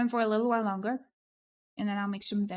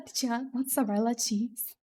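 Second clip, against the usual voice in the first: ordinary talk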